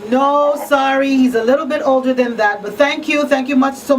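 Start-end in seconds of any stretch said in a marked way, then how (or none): none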